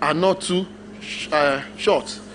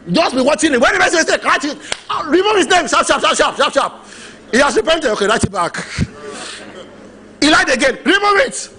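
raised, brisk orders to an angel; mock heavenly command; 6 semitones higher than usual. God directing the angel, brisk raised orders. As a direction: snap out commands to the recording angel.

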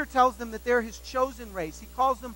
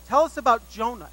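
professor's demand; 2 semitones higher, 10 dB louder than usual. Retold or imitated. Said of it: "he says".

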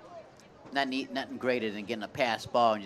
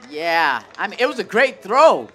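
high, very loud praise of a play; worked-up ballgame commentator; excited reaction; 6 semitones higher, 12 dB louder than usual. Real, not acted. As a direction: exclaim over it with the voice raised.

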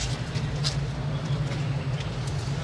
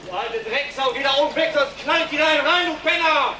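furious screaming, pitch far higher; roaring threats in wild rage; berserk rage. Real, not acted. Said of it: "Das Gebrüll des Verbrechers".

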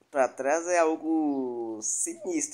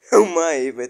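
exaggerated outburst; 3 semitones higher, 12 dB louder than usual. Real, not acted. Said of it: "Tô exagerando um pouco aqui".

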